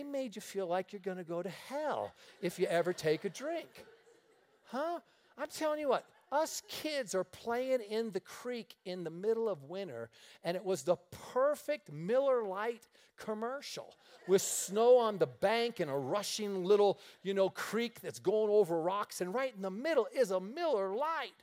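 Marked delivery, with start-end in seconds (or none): none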